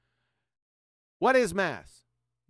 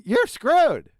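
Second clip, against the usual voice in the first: gloating shout; 7 semitones higher, 7 dB louder than usual. Real, not acted. Gloating at opponents after quote.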